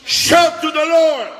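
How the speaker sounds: high, loud and slow shout. Shown as a demonstration. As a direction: call out to the Lord with everything. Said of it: "En anglais, shout". (crier fort, haut et lentement)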